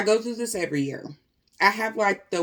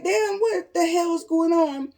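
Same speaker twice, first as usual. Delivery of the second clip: retold shock; 8 semitones higher than usual. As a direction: blurt it out in alarm. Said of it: "I'm like".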